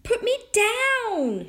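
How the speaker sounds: very high, loud, drawn-out tiny voice; teeny tiny shout. A narrator's reading. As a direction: shout as a tiny boy demanding release. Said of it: "shouted Tom's teeny tiny voice".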